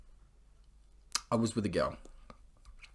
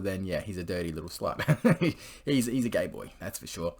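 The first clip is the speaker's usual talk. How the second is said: normal talking voice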